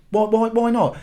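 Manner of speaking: ordinary delivery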